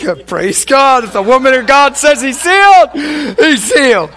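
high, booming mock proclamation; loud hearty agreement. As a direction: holler your agreement, pitched well up. Acts giving in to the wife.

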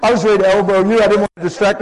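playful and loud; excited teasing. Real, not acted. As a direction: call her out with delight.

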